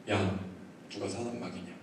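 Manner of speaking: low, quiet, measured rebuke; voice of God. Retold or imitated. God questions the shamed pastor, low and quiet. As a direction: ask softly with restrained divine authority. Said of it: "하나님께서 저에게 한번 물으시더라고요".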